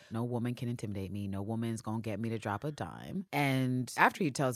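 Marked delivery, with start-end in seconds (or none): 0.1-3.3 low, quiet sexist blowhard voice; a hint of a cocky thug, low and soft; mock macho. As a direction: mutter it like a smug chauvinist. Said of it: "he is back on his sexist, chauvinist shit".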